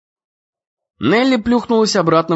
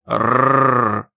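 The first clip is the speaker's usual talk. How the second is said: low growled rrrr like a dog; 4 semitones lower than usual. A narrator's reading. The huge dog growls at the boys, a low growl.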